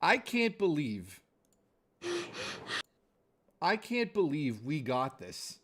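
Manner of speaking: normal talking voice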